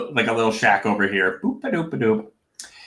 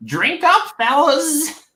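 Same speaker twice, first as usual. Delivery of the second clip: much higher goblin voice urging a drink; goblin host; much slower than usual. Acted. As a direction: squeak out the toast like a tiny goblin. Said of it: "he's like".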